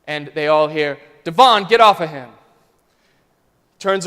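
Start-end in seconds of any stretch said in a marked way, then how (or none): 1.2-2.3 loud, firm command. Retold or imitated. Principal ordering a boy off another, commanding shout. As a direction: cut through the noise with authority. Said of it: "the commanding voice of a woman".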